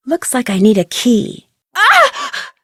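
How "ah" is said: a sharp, high startled cry; short frightened gasp of surprise; startled yelp. Acted. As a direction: jump at a sudden fright. Startled by something in game.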